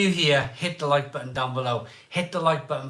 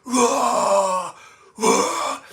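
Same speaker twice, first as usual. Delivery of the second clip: higher, louder wailing whoas; shouting whoa; 9 semitones higher, 8 dB louder than usual. Retold or imitated. Bent over in a hedge, shouting high wailing whoas. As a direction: bellow it out like you're about to be sick. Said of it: "I'm shouting when I do it".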